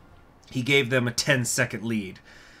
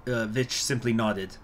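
usual speaking voice

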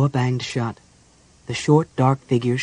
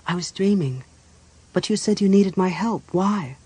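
lightly voiced woman, higher pitch; a touch of puzzlement, pitched up; questioning priestess; 6 semitones higher than usual. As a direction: ask in a higher character voice. Narrator voices Chrysania.

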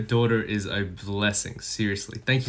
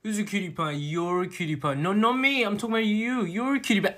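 high, cutesy cooing; baby talk; 9 semitones higher than usual. Acted. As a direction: coo playfully at your sweetheart.

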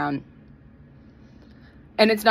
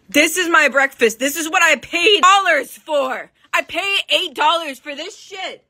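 indignant rant; 6 semitones higher, 4 dB louder than usual. Real, not acted. Ranting about eight dollars.

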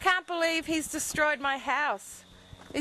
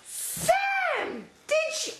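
shocked high cry; 11 semitones higher, much slower than usual. Real, not acted. Discovers more damage.

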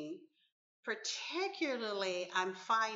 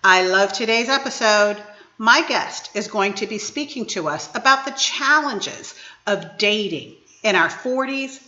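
lively, emphatic podcast welcome; show host intro; 16 dB louder than usual. Acted. Host welcomes everyone to the episode, upbeat announcer style. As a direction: greet listeners with a big, punched hello.

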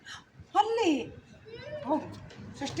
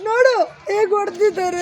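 loud, slow mock wail; acted tearful reproach, loud and stretched; demonstrated crying; 3 semitones higher, 9 dB louder, slower than usual. Shown as a demonstration. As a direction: draw it out as if crying. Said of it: "ಅದನ್ನ ಅಳ್ತಾ ಹೇಳ್ಬೇಕು".

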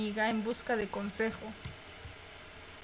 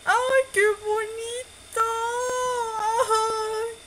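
high, slow gushing squeal, much louder; delighted squeal; 11 semitones higher, 6 dB louder, much slower than usual. Real, not acted. Player squealing at a sweet scene on screen, high delighted squeal. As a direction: melt over the cute scene out loud.

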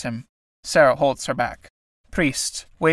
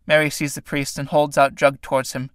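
ordinary delivery, nothing marked

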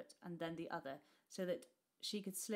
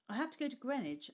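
awkward; 7 semitones higher, 4 dB louder, faster than usual. Acted. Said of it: "I said awkwardly".

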